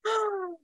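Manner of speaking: high gasp of delight; sharp high intake of breath; surprised gasp. Real, not acted. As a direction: gasp in shock. Host reacts to twist.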